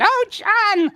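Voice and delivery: high gushing mom impression; mock mom voice. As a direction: squeal it like a doting mother. Voicing his mom.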